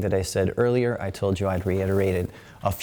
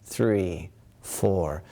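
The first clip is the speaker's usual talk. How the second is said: guided breathing count. Acted.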